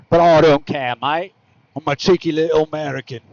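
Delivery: badly faked English cheekiness; rough English accent. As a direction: put on a sort-of English accent. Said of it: "That was kind of an English accent".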